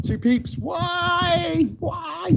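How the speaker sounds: very high, stretched-out squeaky questioning; mock baffled squeal. Streamer puzzles over a chat message, mock baffled squeal. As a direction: squeal in exaggerated bewilderment.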